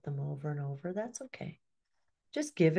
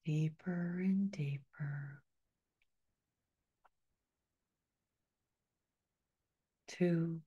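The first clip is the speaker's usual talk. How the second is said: whisper; 4 dB quieter than usual. Real, not acted. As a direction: breathe the words out in a whisper. Whispered countdown in meditation.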